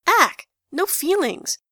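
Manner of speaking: high, loud yelp of objection; mock disgusted protest. A narrator's reading. Gavroche protesting to the others, high yelp.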